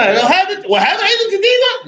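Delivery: high, loud, amazed shout over new things; a parent surprised at the son's new purchases; excited exclaiming. Acted. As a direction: marvel loudly at all the new stuff. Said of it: "You know".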